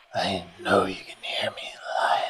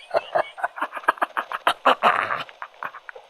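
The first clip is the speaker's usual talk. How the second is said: whispered evil laugh. Acted.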